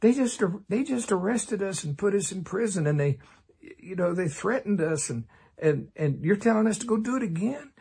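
high, incredulous whining protest. Acted. Preacher mocking timid modern Christians, high whining protest. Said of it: "modern Christians would go".

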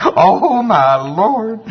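high, loud, stretched-out wail; hammed-up exasperated plea, drawn out; mock complaint to God. Preacher imitating believers complaining to the Lord, hammed-up wail. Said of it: "A lot of us say that to the Lord".

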